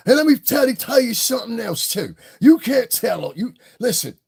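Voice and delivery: high, loud mock Cockney; Cockney accent. Shown as a demonstration. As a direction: lay on a pushy Cockney accent. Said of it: "But that Cockney accent".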